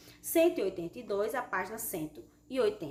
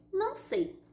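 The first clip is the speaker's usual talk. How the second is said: Não sei pitched up high, wondering; expressive reading; 10 semitones higher, slower than usual. Shown as a demonstration. Teacher reads the fish's answer expressively, pitched high. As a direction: say Não sei with a lifted, puzzled tone. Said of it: "importância da entonação da voz".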